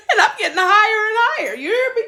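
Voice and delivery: high, fast, laughing and teary; overwhelmed telling, voice cracking; excited. Real, not acted. Retelling rising, laughing and crying.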